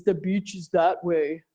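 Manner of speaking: put-on a light Austrian bodybuilder accent; a hint of an eighties action-movie hero; Arnold Schwarzenegger. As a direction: toss off a quick action-hero impression. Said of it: "the Arnold Schwarzenegger".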